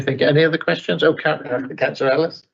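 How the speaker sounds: ordinary talk, nothing marked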